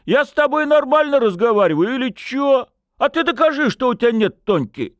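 high-pitched tipsy arguing; aggrieved drunken demand; indignant. A narrator's reading. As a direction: keep protesting, high and pushy. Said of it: "возмутился парень".

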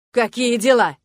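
the coach loses it; exasperated coach. Acted. Loud exasperated coach. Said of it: "Тренер готов лезть на стену".

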